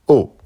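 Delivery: O short, loud, falling from high; fourth tone on O. Shown as a demonstration. Teacher showing learners Mandarin O, falling tone. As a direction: drop O sharply from the top. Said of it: "Quatrième ton".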